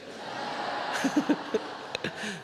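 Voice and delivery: airy laugh. Real, not acted. Preacher laughs at punchline.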